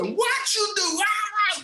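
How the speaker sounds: put-on high, accented screaming coach; imitated scream. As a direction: shriek it like the furious coach. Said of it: "and starts screaming".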